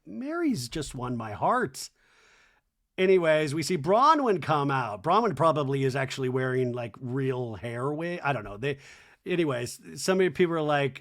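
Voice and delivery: usual speaking voice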